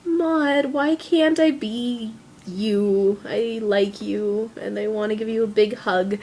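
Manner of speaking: slow, soft, drawn-out fond murmur; adoring wistful plea. Retold or imitated. Fan wishing to hug and be Maud, slow and adoring. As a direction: sigh it out like a smitten admirer. Fan gushing to film character.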